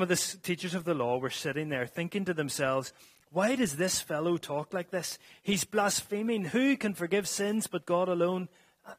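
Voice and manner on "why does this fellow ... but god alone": higher, louder indignant questioning; mock outrage, pitched up; ranting scribes. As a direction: voice the scribes' scandalised inner rant. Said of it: "internal rantings of the scribes".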